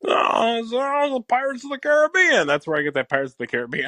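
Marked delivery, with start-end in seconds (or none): 0.1-2.4 higher, stretched out; Carvey Biden. Retold or imitated. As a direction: float up on Caribbean. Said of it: "And he's like".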